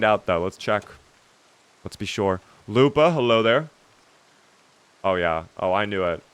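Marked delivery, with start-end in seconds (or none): none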